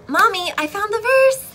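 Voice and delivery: put-on high, loud child's call; excited little daughter. Daughter calling mommy about her verse, excited child. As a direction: proudly announce it in a child's voice. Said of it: "she goes".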